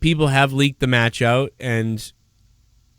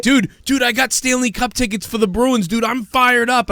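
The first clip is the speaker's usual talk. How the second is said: much higher, hyped-up bro voice; excited buddy; 9 semitones higher than usual. Retold or imitated. Buddy bragging about Bruins tickets, mock excited high voice. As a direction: gush like a fired-up sports fan. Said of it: "my buddy was like".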